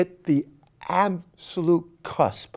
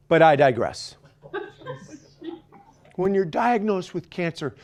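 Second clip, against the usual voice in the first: normal talking voice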